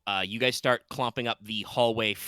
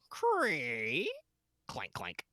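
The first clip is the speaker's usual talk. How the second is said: rusty hinge creak; 6 semitones higher, 5 dB quieter, much slower than usual. Acted. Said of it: "make a rusty hinge sound".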